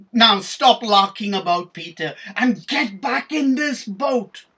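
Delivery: loud, high telling-off; scolding shout. Acted. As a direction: order him back, scolding loudly. Thomas scolds Peter from boat.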